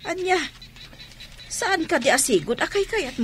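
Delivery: high startled question; surprised. Acted. Mother startled when daughter refuses milk, high question. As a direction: recoil at the refusal.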